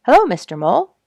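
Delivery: lightly voiced cheery bluebird; friendly bluebird. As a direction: call down brightly to the mole. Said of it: "said Mister Bluebird".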